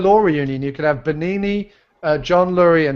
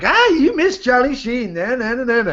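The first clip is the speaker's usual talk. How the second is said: mock taunting; 8 semitones higher than usual. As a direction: taunt like a playground chant. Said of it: "everyone was like".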